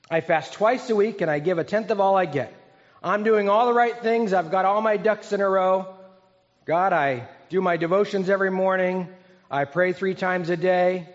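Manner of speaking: boastful prayer, lifted and emphatic; self-righteous Pharisee. Acted. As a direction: list your good deeds proudly to God. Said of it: "Can you hear that tone in his voice?".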